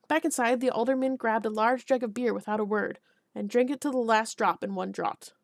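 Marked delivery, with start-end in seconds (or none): none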